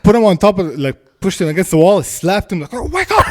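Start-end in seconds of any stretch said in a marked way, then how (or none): 2.7-3.2 high-pitched mock yell; urgent, high slap-accompanying cry; retold wake-up yell. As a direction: re-enact snapping someone out of panic. Retold slapping a panicked man.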